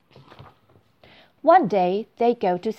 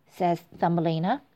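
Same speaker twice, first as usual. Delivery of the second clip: ordinary delivery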